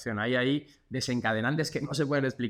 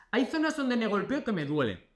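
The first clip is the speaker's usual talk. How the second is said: voicing a patient; 8 semitones higher than usual. Physio voicing a patient finding sore spots, lightly whiny. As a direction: play the sore patient, higher.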